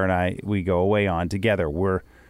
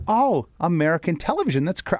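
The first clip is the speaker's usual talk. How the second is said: higher-pitched pleasant surprise; retold surprise; 8 semitones higher than usual. Traveller retelling finding American TV in Cuba, surprised. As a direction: react as if just spotting it on screen. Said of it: "I'm like".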